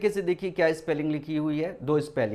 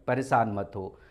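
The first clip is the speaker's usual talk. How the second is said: normal talking voice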